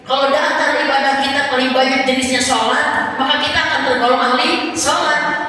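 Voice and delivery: high, loud, fervent preaching; full-force preaching. Acted. Preacher rousing the women in the audience, loud and fervent. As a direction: preach it out to the congregation with fire.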